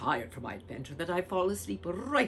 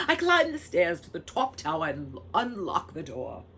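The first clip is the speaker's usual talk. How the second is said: ordinary delivery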